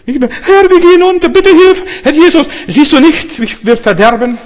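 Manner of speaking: high, loud desperate plea; panicked cry for help. Acted. Disciples in the sinking boat crying to Jesus, panicked shriek. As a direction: wail for rescue like someone sinking.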